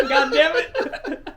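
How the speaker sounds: slow, high, loud curse broken by laughter; exasperated curse. Retold or imitated. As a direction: groan it out, laughing in disbelief. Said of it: "And she's like".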